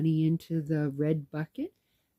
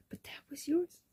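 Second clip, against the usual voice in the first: high, half-whispered protest; retold hushed objection, pitched way up; imitating disbelief; 12 semitones higher, 4 dB quieter than usual. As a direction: play the astonished client softly. Client's retold protest at gift.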